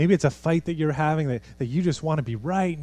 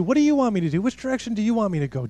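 anxious asker; 7 semitones higher, 3 dB louder, faster than usual. Retold or imitated. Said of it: "no looking to".

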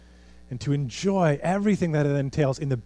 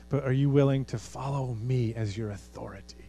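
ordinary delivery

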